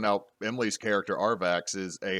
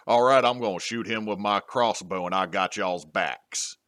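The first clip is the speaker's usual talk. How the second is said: mock Texan dwarf; put-on Texas twang for a fantasy dwarf; Texan accent; 3 dB louder than usual. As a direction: imitate a Texan dwarf, slowing at the end. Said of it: "so all their dwarves are Texan".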